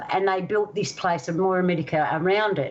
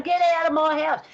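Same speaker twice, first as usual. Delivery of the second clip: much higher mock yell; ghost yelling; 8 semitones higher than usual. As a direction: shout him off the land like an angry ghost. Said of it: "yelling out".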